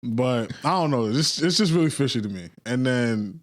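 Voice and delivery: usual speaking voice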